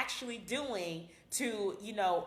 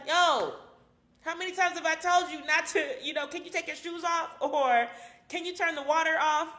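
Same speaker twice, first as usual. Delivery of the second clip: pitched up and louder, exasperated nagging; irritated scolding; 7 semitones higher, 4 dB louder than usual. Retold or imitated. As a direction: snap at your partner over nothing, pitch raised. Scolding partner over little things.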